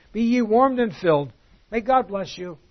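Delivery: hammed-up, hollow well-wishing; mock pious blessing. Mocking empty words to the needy.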